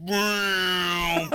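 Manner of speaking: mock plane dive whoosh; dive bomber noise. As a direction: imitate a bomber swooping down. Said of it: "make, like, fucking dive bomber noises".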